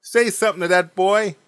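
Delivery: imitated mother's high, loud demand; exasperated. Mother demanding dad deal with the boy, exasperated. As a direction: raise pitch and volume sharply, at wit's end. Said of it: "looks at him in total exasperation".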